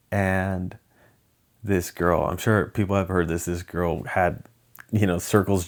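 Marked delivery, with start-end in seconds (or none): none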